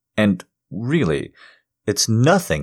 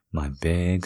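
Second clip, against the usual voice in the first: normal talking voice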